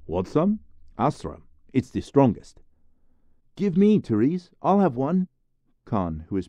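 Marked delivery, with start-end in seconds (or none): 0.1-2.6 high, loud show-off offer; boastful boy. Acted. Therese offers Jesper a cigarette, high bragging boy. 3.6-5.3 very high, loud and eager; eager boy. Acted. Khan begs Therese for a cigarette, high eager voice.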